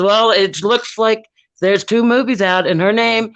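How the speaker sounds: mild imitation of a clerk reading the account; clerk reading the screen. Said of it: "And he goes".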